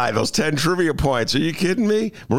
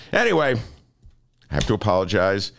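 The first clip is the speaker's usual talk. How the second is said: ordinary delivery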